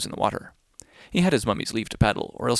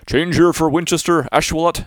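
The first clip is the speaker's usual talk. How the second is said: loud, higher station announcement; train conductor call; 6 semitones higher, 8 dB louder than usual. Acted. The whale announces the stops like a conductor, loud. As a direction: bellow the stops like a railway guard.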